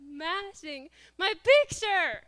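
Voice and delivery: loud, high, drawn-out comic wail; mock outraged yell. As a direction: cry out in hammed-up outrage. Reliving the smashing in outrage.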